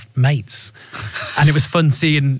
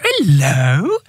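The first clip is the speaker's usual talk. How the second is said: put-on baby voice. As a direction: coo it like a big bloke to a baby. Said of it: "reduced to, like, baby voice".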